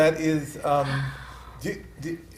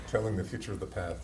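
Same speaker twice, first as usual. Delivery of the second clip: laughing; 5 semitones lower, 9 dB quieter than usual. Real, not acted. Co-host laughs repeating the phrase.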